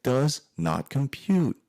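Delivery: high, slow robotic bafflement; mock robot. Hardcore musician baffled by a smiling crowd, mock robot voice. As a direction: spell it out slowly like a confused computer.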